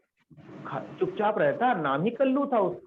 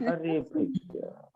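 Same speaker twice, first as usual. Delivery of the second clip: normal talking voice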